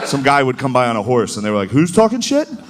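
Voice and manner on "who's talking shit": mock eager soldiers. Soldiers asking for news.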